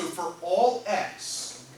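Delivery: drawn-out, deliberate words; slow dictation. Shown as a demonstration. Teacher reading the formula aloud to the class, slow dictation. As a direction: let them copy each word.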